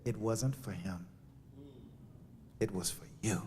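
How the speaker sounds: quiet inner voice. Retold or imitated. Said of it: "the voice said".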